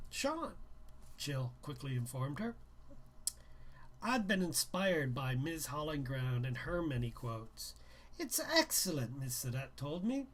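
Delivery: reading a novel aloud, a little livelier; brisk read-aloud narration; story narration. A narrator's reading. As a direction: tell the story to listeners. Reads novel aloud to viewers.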